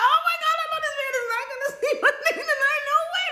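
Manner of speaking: very high, loud, laughing freak-out; mock horror. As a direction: squeal in hammed-up horror. Voicing patient's horrified refusal.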